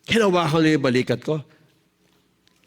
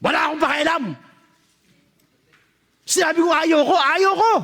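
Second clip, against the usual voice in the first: acting tough; 11 semitones higher than usual. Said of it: "Nagtigas-tigasan ako".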